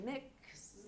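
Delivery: soft "Mixed" with the ending k-s-t drawn out; sounded K S T. Shown as a demonstration. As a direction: break out each consonant at the end of "Mixed". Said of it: "It sounds like a K S T".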